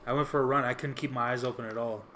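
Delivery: ordinary talk, nothing marked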